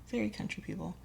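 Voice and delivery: an under-the-breath echo of very country people; soft echo. Real, not acted. Quiet repeat for emphasis.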